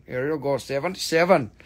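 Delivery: normal talking voice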